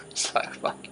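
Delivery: a whispered fed-up swear; whispered disbelief at the butter claim; exasperated whisper. Real, not acted. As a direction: breathe the curse out unvoiced. Exasperated aside after mocking claim.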